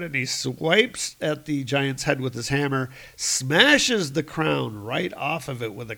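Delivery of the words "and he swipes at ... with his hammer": ordinary talk, nothing marked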